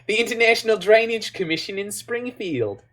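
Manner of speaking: put-on high-pitched little boy with an Australian accent; high mock child voice; cute kid impression. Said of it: "that kid is so freaking cute".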